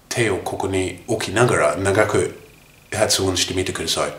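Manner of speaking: usual speaking voice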